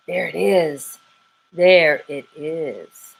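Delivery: drawn out, stunned; a slow, hypnotized murmur; slow awed repeat. Acted. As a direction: stare and repeat it slowly. Squirrel fixated, slow repeat.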